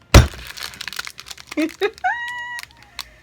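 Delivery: loud, high squealing laughter; startled laugh. Real, not acted. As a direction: shriek with laughter after a jolt. Startled by popping pack.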